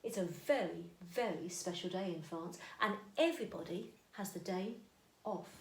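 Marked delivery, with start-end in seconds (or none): none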